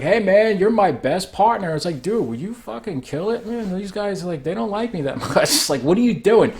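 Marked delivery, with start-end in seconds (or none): 0.0-1.8 high, loud mock yell of a happy friend; hammed-up greeting, shouted high and loud; Greef Karga yelling. Greef Karga hailing the Mandalorian across the cantina, high mock yell. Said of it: "Greef Karga is just kinda like". 2.0-6.5 high put-on voice, half laughing, a bit hushed; mock nervous plea in a raised voice; Greef Karga pleading. Greef Karga asking the Mandalorian to ease off the bounty hunters, high mock plea. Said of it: "Greef Karga is just kinda like".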